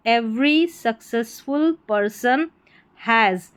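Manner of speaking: very slow model reading; slow, deliberate reading practice; word by word. Shown as a demonstration. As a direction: separate each word for learners. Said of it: "पढ़ना है टुकड़ों में".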